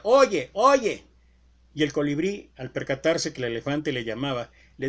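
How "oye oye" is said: loud, high call of Oye, oye; big shouted elephant call to a tiny bird; elephant shouting. Acted. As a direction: shout it out to get the hummingbird's attention. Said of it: "le empezó a gritar".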